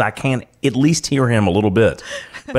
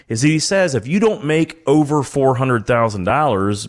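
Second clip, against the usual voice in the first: normal talking voice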